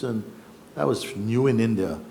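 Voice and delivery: ordinary delivery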